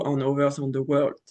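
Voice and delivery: normal talking voice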